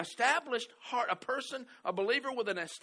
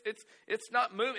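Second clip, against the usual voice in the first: usual speaking voice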